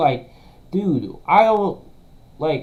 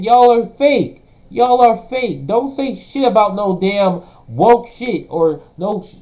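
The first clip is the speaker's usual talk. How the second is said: loud, higher, yelling insults; furious rant; 6 semitones higher, 5 dB louder than usual. Real, not acted. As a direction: rip into them at full volume. Streamer ranting at critics.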